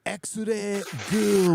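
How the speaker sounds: put-on over-the-top accent on X-ray goo; thick exaggerated local accent; overdone thick local accent. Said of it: "with the voice".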